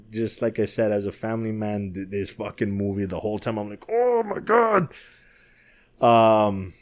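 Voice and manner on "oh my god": high-pitched, drawn-out mock wail; mock anguished exclamation. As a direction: stretch it out high in exaggerated distress. Mimics his reaction to the film.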